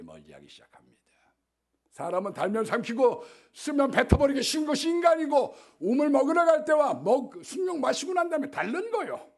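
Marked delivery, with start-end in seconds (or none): 1.9-9.3 크게 높여 외치는 설교; 목소리를 확 끌어올려 힘주어 설교하듯. Acted. Preaching burst on human nature. (사람의 간사함을 꾸짖으며 높고 크게 외치는 설교)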